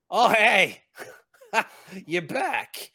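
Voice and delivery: high, loud goblin greeting; excited goblin. Acted. Goblin greets a returning passenger, high excited cry. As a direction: squeal a delighted welcome back. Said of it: "pulls his hand out of his jacket and just goes".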